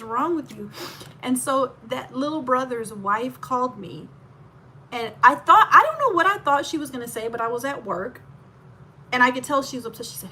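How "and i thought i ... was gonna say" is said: ordinary talk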